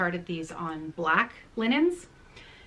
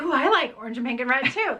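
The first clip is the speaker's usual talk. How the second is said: quick, brighter mock eagerness; imitating an eager child; eager agreement; 5 semitones higher, 3 dB louder, faster than usual. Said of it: "He's like".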